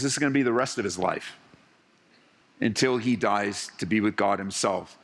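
ordinary delivery

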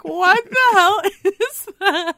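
startled disbelief. Retold or imitated. Startled by the sound effect.

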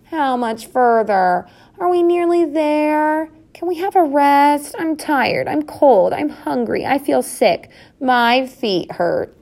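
high, drawn-out whining youngsters; piping children's complaints. A narrator's reading. Tired young mice complain to parents, piping whine. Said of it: "piped the Mousekins".

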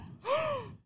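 high exaggerated gasp; mock shocked gasp. Real, not acted. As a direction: gasp in fake surprise. Shocked at correct guess.

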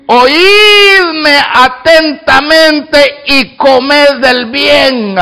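every word stretched very slowly; drawn-out verse. Acted. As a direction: lean on each word of the verse.

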